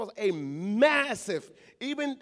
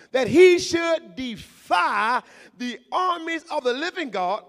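loud, raised preaching voice; preaching at full force; 3 semitones higher, 4 dB louder than usual. Acted. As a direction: drive the point home to the congregation.

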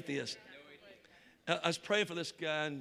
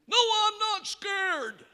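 high, loud, drawn-out protest; indignant yell; 13 semitones higher, 7 dB louder, much slower than usual. Retold or imitated. Atheist yells back at the taunting preacher, indignant yell. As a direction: yell it slowly as a hammed-up denial.

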